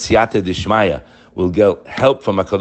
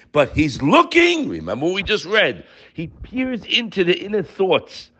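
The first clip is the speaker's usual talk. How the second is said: impassioned preaching; 11 semitones higher than usual. Real, not acted. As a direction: exhort them high, stretching the key phrases. Preaches at full force.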